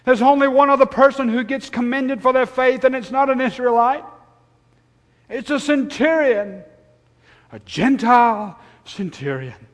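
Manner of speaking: loud, high, insistent sermon voice; impassioned preaching. Real, not acted. Preacher stressing that a Gentile was commended, impassioned. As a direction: drive the point home forcefully.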